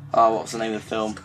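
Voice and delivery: ordinary talk, nothing marked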